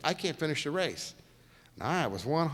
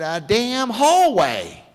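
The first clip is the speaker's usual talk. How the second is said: slow, much louder and higher exasperated boss; the boss losing patience with a driver, hammed-up slow bark; imitating the boss; 8 semitones higher, 11 dB louder, much slower than usual. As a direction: bark it out slowly, fed up. Imitating France emphatically.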